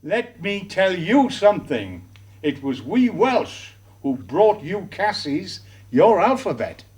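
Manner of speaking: usual speaking voice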